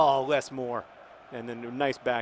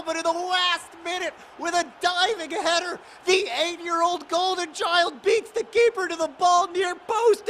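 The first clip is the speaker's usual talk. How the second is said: ecstatic commentary; 12 dB louder, slower than usual. Real, not acted. Celebrating last-minute winning goal.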